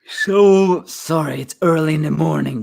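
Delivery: talking through a yawn; sleepy, stretched-out yawn. Real, not acted. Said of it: "That's why I'm yawning so much".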